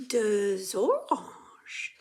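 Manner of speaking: "Deux oranges" linked as "deu-zoranges", slow; drawn-out liaison demo. Shown as a demonstration. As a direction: join the Z into "oranges". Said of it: "kind of blends together".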